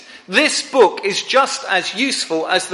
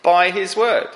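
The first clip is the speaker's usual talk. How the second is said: much higher, friendly voice of a kindly corrector; gentle correction; 6 semitones higher than usual. Retold or imitated. Believer answering the prophecy crowd, higher gentle correction. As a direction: nudge them back to scripture kindly. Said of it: "you just say".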